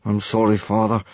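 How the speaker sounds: subdued, mild mumbled apology; miserable mumble. A narrator's reading. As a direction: apologise glumly, without force. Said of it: "Hiccup mumbled miserably".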